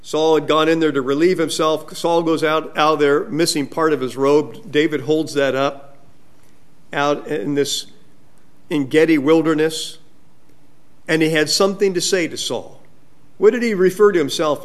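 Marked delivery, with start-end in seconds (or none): none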